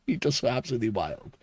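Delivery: ordinary talk, nothing marked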